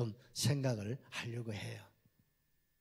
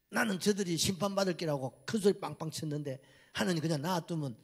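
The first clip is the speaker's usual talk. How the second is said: higher, louder whining protest at God; sulky complaint; 5 semitones higher, 4 dB louder than usual. Retold or imitated. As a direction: complain like a spoiled prophet. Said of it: "막 달라드는 거예요".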